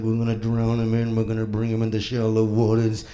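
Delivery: low boat-captain impression; Quint. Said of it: "And Quint's like".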